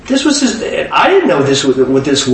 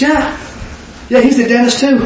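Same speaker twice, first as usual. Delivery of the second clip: eager confirming; 10 semitones higher than usual. Retold or imitated.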